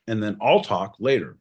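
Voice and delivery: ordinary talk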